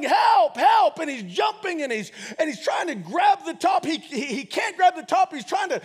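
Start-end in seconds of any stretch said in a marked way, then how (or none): 0.0-1.0 loud, high acted yell; hammed-up shout for rescue; yelling for help. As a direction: cry out like someone trapped. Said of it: "He's yelling".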